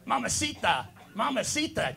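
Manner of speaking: high, playful Spanish catcall; mock Spanish catcall. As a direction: coo it like a cheesy flirt calling out. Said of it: "the extent of my Spanish".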